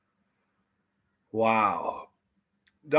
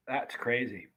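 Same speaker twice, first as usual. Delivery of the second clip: quiet amazement; 8 dB quieter, slower than usual. Real, not acted. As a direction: murmur "that's crazy" under the breath, awed. Reacting to heat, quieter take.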